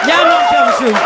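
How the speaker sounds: comic squeal; squeaky aside. Acted. Comedian telling the audience it's true, high squeak.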